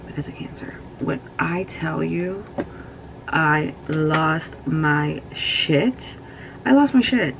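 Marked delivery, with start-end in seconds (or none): 3.3-6.2 each word stretched slowly; slow and drawn out. Real, not acted. Grieving vlogger slowly recalling losing her cat. Grief over cat, repeated. 6.6-7.3 I lost my shit said louder and higher. Real, not acted. Grieving vlogger loudly insisting she fell apart. Grief over cat, repeated.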